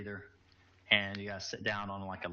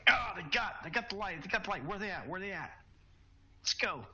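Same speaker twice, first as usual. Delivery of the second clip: high, excited shouting soldiers; enemy soldiers' voices; 10 semitones higher than usual. Acted. Soldiers shouting to each other after the light goes out. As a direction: call out like panicked troopers hunting in the dark. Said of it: "you hear voices like".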